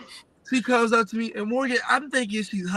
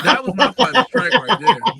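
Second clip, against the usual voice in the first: laughing; 5 semitones higher, 8 dB louder than usual. Real, not acted. Laughing while recalling the bite.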